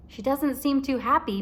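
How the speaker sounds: ordinary delivery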